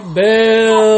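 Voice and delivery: loud, imitated audience boo; crowd booing. Podcaster retelling how the audience jeered a delayed show, loud mock boo. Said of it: "everybody was like".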